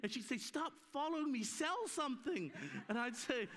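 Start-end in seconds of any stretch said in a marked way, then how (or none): 0.4-2.5 wife's scolding. Retold or imitated. Wife scolding him retold.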